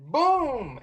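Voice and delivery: a high, clipped boom like a rifle crack; mock gunshot. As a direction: spit it out like a shot. Mimicking the rifle shots.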